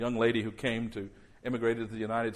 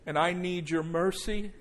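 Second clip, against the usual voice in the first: fervent guided prayer; leading prayer; 2 semitones higher than usual. Acted. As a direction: lead them in prayer, line by line. Leads congregation in prayer.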